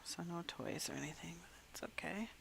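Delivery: soft disappointed whisper. Real, not acted. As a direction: breathe it out, let down.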